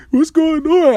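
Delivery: high mock-weepy bewilderment; imitated crying. Man crying over sad songs, imitated weepy confusion. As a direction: wail it lightly, as retold tears. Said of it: "Then I start crying".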